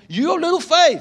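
loud, high-pitched scolding outburst; stern rebuke. Acted. Preacher as Jesus refusing to soothe the anxious, loudly rebuking. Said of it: "Jesus rebukes them".

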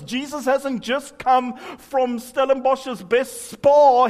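ordinary delivery

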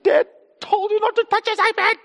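high-pitched mock scolding yell; exasperated, very high imitation of shouting; re-enacted parental shout. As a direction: act out a dad losing it at his kid. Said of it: "I shout at this poor kid".

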